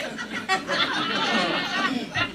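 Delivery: a real laugh, high and bursting out. Real, not acted. Laughing at how absurd her past was.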